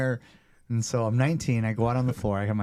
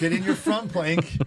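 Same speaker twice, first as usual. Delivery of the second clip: higher, slow, forced cheery trainer voice; awkward deflection; 8 semitones higher, 4 dB louder than usual. Retold or imitated. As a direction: brush past the tears like nothing happened. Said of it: "So I'm like".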